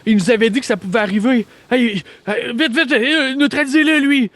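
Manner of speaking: high-pitched, urgent squawk of alarm; panicked character voice. Acted. Stacy begs them to neutralize the rising corpse, high panicked voice. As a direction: shriek it in a high, hammed-up panic. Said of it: "Puis Stacy a dit".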